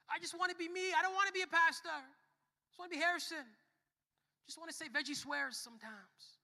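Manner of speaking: high, loud acted tantrum; mad protest. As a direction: protest loudly like someone fed up with the rules. Acting out his old mad protest.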